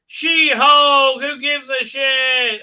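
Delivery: high, loud, drawn-out jeer; mock title shout. Host dismissing She-Hulk, mocking shout. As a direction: holler it like a sarcastic show announcement.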